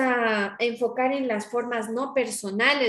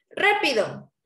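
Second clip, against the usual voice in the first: a short urgent "Rápido" pitched up; sharp command; 4 semitones higher, slower than usual. Shown as a demonstration. Teacher showing an order in class, urgent and sharp. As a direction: snap out the order. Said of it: "Ahí está la orden".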